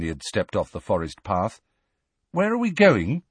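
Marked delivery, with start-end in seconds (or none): 2.3-3.2 put-on much higher, wondering boy's voice; uneasy question. A narrator's reading. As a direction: ask it with some unease. Harry uneasy, deep in forest.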